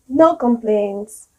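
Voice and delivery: flat, dragged-out NO COMPLAINTS; complaining tone. Shown as a demonstration. Teacher showing how intonation can contradict the words, slow and complaining. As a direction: say it as if you have plenty of complaints. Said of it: "sound like you have a lot of complaints".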